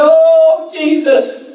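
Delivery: high, loud overwhelmed gasp of awe; awed old man. Retold or imitated. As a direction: break out in wonder. Homeless man voiced in story.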